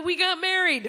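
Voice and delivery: proud, loud announcement; triumphant reveal. Real, not acted. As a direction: land the payoff with a burst. Punchline of the romance story.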